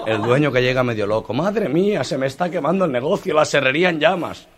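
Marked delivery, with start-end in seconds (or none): 1.3-4.5 much higher, alarmed cry; panicked owner. Acted. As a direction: boss watching his sawmill burn. (dueño alarmado)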